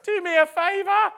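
high, loud, laughing disbelief; Sarah laughing. Retold or imitated. Preacher voicing Sarah laughing at God's promise of a baby. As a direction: scoff through laughter at the idea. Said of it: "Sarah laughed".

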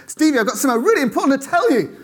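high, rushed, bursting to tell; excited news. Retold or imitated. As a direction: blurt it out fast to a friend. Bursting with news at friend's door.

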